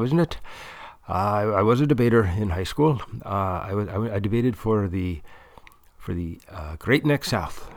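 put-on funny, muddled Irish accent with a lilting rise; put-on Irish interviewee voice; sort-of-Irish accent. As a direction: do a bad Irish accent that wanders toward Scottish or Welsh. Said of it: "He will talk like an Irish person".

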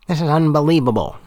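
put-on high, sharp old woman's voice; sharp and reedy, full of disgust; thin reedy voice. A narrator's reading. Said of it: "in her sharp, reedy voice".

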